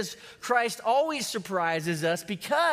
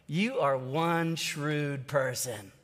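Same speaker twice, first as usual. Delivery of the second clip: lower, softer, slow, a touch of a knowing master; lightly voiced master; 8 semitones lower, 5 dB quieter, much slower than usual. Master to his money manager, lower, slow and soft. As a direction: let the master savor it, low and slow.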